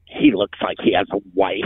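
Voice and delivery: low, grumbled mock disgust; eye-rolling disdain. Imitating angry locals judging a stranger, low eye-rolling disdain. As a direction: mutter it low like an eye-roller. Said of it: "like, roll their eyes".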